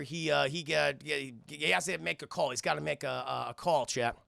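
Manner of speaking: ordinary delivery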